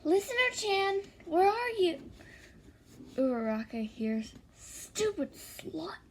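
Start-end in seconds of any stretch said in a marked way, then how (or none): 0.0-2.0 high-pitched singsong search call; pitched-up girlish character call. A narrator's reading. Uraraka calls out searching. 4.6-6.0 muttered insult. A narrator's reading. Ex-boyfriend insults listener.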